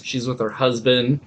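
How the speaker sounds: ordinary talk, nothing marked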